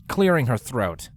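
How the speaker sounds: usual speaking voice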